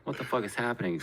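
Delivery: usual speaking voice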